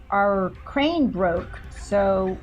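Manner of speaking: ordinary delivery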